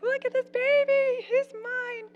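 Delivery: very high, slow and gushing; squealing proud mother; proud new mom. Retold or imitated. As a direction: show off the baby with giddy pride. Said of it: "I'm like".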